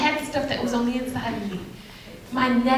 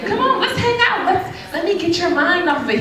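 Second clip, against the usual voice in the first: much higher, coaxing, inviting voice with a laugh; mock smooth guy; 6 semitones higher than usual. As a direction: imitate a guy sweet-talking her over. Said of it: "any other guy would have been like".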